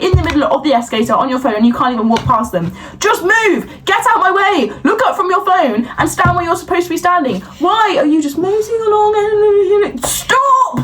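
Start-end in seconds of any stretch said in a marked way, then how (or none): 3.0-10.9 high, exasperated yelling at strangers; high-pitched exasperated rant; angry rant. Acted. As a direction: get out of my way, fed up. Raging at escalator blockers.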